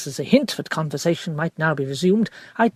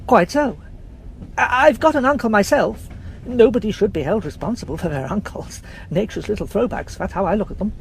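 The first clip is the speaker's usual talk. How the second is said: lightly voiced chap, breezy and joking; jovial young man; 5 semitones higher than usual. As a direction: toss it off cheerfully. George jokes about uncles.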